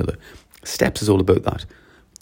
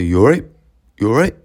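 louder, informal rising question; casual street greeting; 3 semitones higher, 5 dB louder than usual. Shown as a demonstration. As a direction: toss it out like a greeting to a mate. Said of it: "We say that outside".

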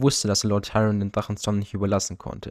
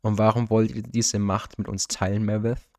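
usual speaking voice

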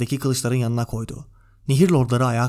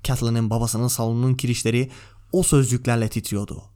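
usual speaking voice

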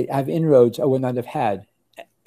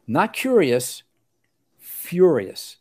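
"curious" and "furious" each pitched up and punched; deliberate, louder stress on "furious"; emphatic correction; 5 semitones higher, 4 dB louder, much slower than usual. Real, not acted. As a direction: set "furious" against "curious" with a pause between.

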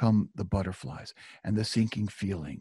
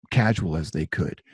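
ordinary delivery

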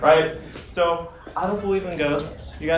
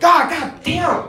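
slow, high startled "God damn"; startled curse; 13 semitones higher, 8 dB louder, much slower than usual. Retold or imitated. Comedian startled by homeless man in parking lot, slow high curse. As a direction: drag out the shock of being jumped. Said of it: "I'm like".

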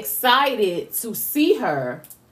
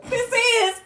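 tearful; 12 semitones higher than usual. Real, not acted. Emotional moment recognizing her mother.

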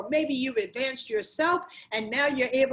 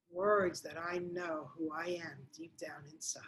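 unvoiced hush, much softer; whisper; 5 semitones lower, 9 dB quieter than usual. Real, not acted. Coach whispering to listener about who she truly is, hushed. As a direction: breathe it out close to the mic.

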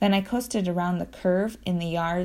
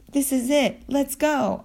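yelled; 7 semitones higher than usual. Acted. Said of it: "I yelled to Ashwin".

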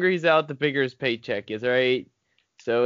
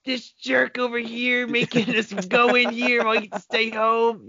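mock angry rant; 10 semitones higher than usual. Mocking the superintendent angrily.

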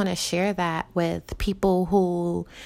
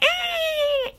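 sudden shrill squeak, far higher, like a cry; squeaky upset voice. Shown as a demonstration. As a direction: let the voice crack way up when upset. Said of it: "my voice is just like".

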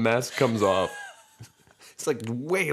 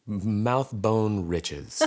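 slow; much slower than usual. Acted. Slow mock grand phrase.

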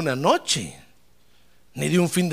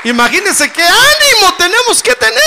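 high, loud shout of praise; exultant preaching; 11 semitones higher, 9 dB louder than usual. Real, not acted. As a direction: burst into full-force exultation. Preacher exults over eternity. (exclamación exaltada de predicador)

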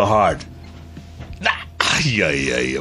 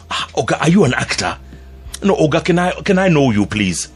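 put-on Nigerian-accented guard, pitched up and eager; curious guard asking who the traveller is; security guard voice; 7 semitones higher than usual. Airport security guard asking a stranger, eager Nigerian-accented voice. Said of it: "He says".

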